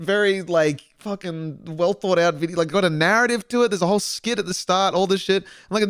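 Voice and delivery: usual speaking voice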